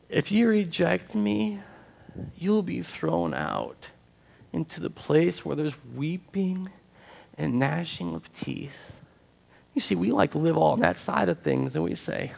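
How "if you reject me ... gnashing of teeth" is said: slow, softened warning with weighty pauses; hushed warning. Acted. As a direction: deliver a grave warning slowly and softly. Preacher solemnly quotes Jesus's warning.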